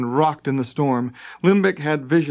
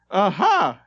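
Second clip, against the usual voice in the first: high triumphant aha; shouted; 6 semitones higher, 3 dB louder than usual. A narrator's reading. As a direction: pounce on the point. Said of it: "shouted Limbeck".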